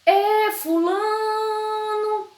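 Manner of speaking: high, sing-song long-distance call; mother calling out. Acted. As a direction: stretch the vowel out and hold it high. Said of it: "Quando a mãe da gente vai chamar a gente".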